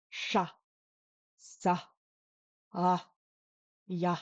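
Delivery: sha, sa, ah, ya in low tone; each syllable set low, spaced out. Shown as a demonstration. Teacher recaps the low tone row, syllables in low tone. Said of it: "This is our low tone row".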